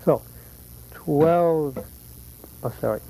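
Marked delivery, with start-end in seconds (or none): none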